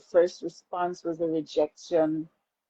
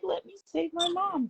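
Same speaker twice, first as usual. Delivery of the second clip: put-on childlike baby voice; 12 semitones higher, 3 dB quieter than usual. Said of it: "they were like".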